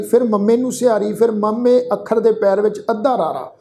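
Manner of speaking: usual speaking voice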